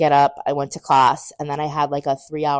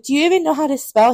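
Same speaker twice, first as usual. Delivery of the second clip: high, loud, quick teasing; joking challenge; 12 semitones higher, 9 dB louder, faster than usual. Retold or imitated. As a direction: tease him with a loud mock challenge. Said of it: "I was joking with him".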